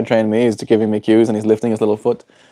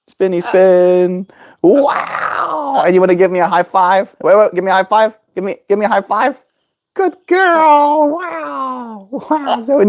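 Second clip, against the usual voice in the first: excited praise; 10 semitones higher than usual. Real, not acted. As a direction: cheer the bird on. Praising bird's tricks.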